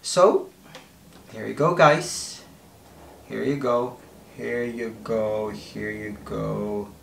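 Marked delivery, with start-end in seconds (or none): 1.3-6.9 fading repetition. Real, not acted. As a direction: let each repeat trail away in awe. Repeats, fading as lid opens.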